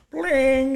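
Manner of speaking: high mock piano bling; piano plink. [name] hitting the untuned piano, high plink. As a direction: plink it like a bad key.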